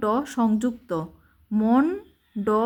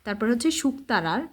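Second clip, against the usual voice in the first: normal talking voice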